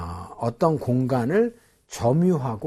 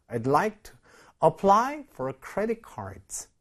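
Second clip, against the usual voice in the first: slow model reading; 5 semitones higher, much slower than usual. Shown as a demonstration. Teacher models the phrase slowly.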